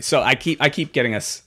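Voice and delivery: ordinary talk, nothing marked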